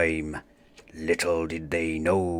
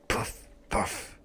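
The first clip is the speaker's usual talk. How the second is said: softer, breathless panting; out of breath; 10 dB quieter than usual. Acted. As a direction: pant after the long chase.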